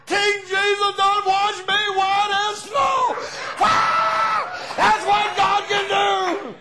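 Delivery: loud, high, slow shouted praise; full-force preaching cry. Acted. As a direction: cry it out to the congregation.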